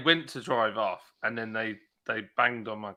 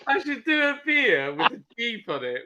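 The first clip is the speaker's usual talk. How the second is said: ordinary delivery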